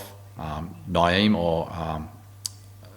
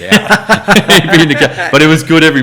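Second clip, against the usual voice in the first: louder, slowed and laughing through it; laughing loudly; 10 semitones higher, 15 dB louder than usual. Real, not acted. Teasing his tall German partner about standing out, loud laughing. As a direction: laugh out the punchline.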